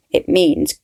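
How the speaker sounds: plain reading voice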